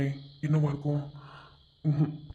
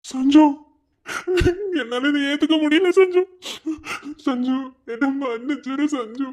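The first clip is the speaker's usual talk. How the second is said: crying breakdown; 7 semitones higher than usual. Acted. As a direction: sob out the apology.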